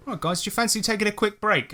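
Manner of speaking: casual peacemaker. Retold or imitated. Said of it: "offers a break to calm things".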